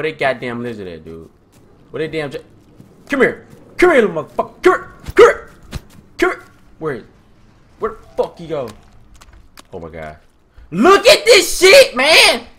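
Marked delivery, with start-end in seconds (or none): 3.1-6.4 high, loud summons; short, raised-pitch bursts; urgent calling. Real, not acted. Chasing a creature in game. 10.7-12.5 very high, loud, stretched-out yell; loud drawn-out squeal of alarm; panicked exclamation. Real, not acted. Panicking at danger in game.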